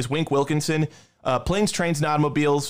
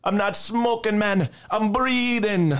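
a mock Jamaican accent, pitched up high; hammed-up Jamaican accent, dragging out the last words slowly; clumsy Jamaican movie-quote accent; bad Jamaican accent; 7 semitones higher, slower than usual. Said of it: "sorry for the bad Jamaican accent impression".

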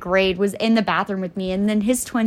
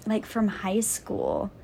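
hushed whispered aside; whispered afterthought; 2 semitones lower, 7 dB quieter, much slower than usual. Real, not acted. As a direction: drop to a breathy whisper. Whispered aside about high school.

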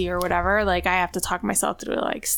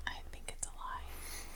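whispering; 23 dB quieter than usual. Real, not acted. Whispered aside.

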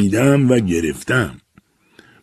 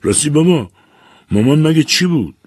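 put-on raised girl's voice, curious; a young daughter asking her dad, a bit higher; daughter asking father; 8 semitones higher, 4 dB louder than usual. A narrator's reading. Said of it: "فرشته پرسید".

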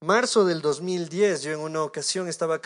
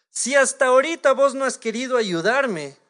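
raised, higher-pitched retold reproach; reproachful; 6 semitones higher, 5 dB louder than usual. Preacher retells blaming God for not helping, reproachful. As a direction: push the complaint out louder and higher.

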